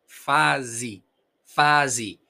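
"Fase" as FA-zi, falling; final I. Shown as a demonstration. Teacher repeating Portuguese "Fase", E as I. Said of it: "nosotros pronunciamos I".

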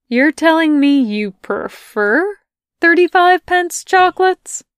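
high, loud disbelieving question; can't believe what she's hearing; incredulous. Acted. Melanie incredulous.

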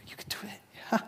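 put-on soft whispered encouragement to a toddler; encouraging whisper. Parent whispering encouragement to a toddler learning to walk. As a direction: breathe it gently like a parent cheering a wobbling child.

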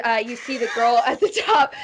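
ordinary talk

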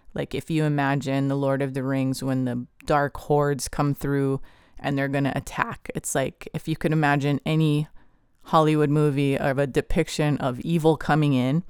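ordinary delivery

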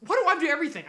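loud, high complaint; exasperated outburst. Real, not acted. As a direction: vent like you're overworked. Siblings arguing over chores.

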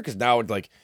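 ordinary talk

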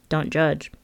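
usual speaking voice

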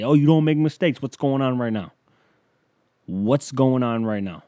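loud mocking shout that slows at the end; sarcastic yelling. Comedian sarcastically yelling at God about mistakes. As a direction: jeer upward, sarcastic. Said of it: "And I just keep yelling that".

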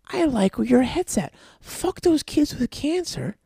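high-pitched, hammed-up agreement; mock gleeful scheming. Acting out a couple plotting a GoFundMe, mock excitement. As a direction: play an eager schemer cheering on a bad idea.

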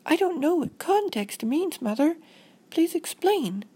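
put-on high-pitched child voice; high, plaintive kid; puzzled little girl. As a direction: ask like an earnest small girl. Voices Robin asking mother.

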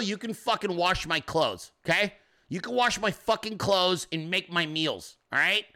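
ordinary talk, nothing marked

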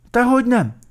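very high, loud whine; piping, aggrieved lament; wailing. A narrator's reading. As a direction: whine the protest plaintively. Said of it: "siránkozott Vacska Mati".

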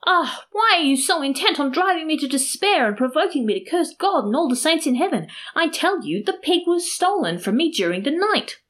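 high, despairing theatrical protest; acted outburst of exasperation, high throughout; loud protest. A narrator's reading. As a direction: plead to be believed, pitched right up. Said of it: "protested loudly".

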